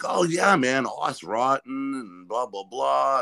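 a lightly hammed kid gushing; mock eager punk kid. Voicing the Florida kid.

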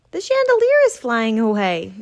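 shout. Acted. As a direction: yell it as it flies off. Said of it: "shouted Ruzel".

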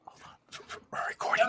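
urgent whisper. Real, not acted. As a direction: hiss it urgently under breath. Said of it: "Why are we whispering?".

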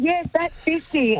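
high, thrilled mock scream; imitated cheer leaping up in pitch; retold excited scream. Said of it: "we've all screamed".

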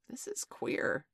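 high, breath-filled, uneasy gasp; half-whispered gasp of alarm. A narrator's reading. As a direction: let it out on a startled breath. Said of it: "Smee gasped".

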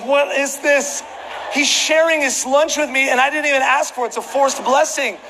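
high-pitched, incredulous complaint; mock exasperation. Preacher retelling a messy seatmate's lunch, mock exasperation. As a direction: protest in a high, comically put-upon voice.

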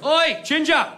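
mock British accent, calling out; British heckler. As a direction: pipe up as an aggressive British shopper. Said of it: "the guy behind me pipes up".

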